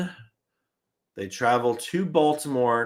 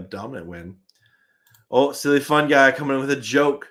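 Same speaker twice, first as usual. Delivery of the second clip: ordinary talk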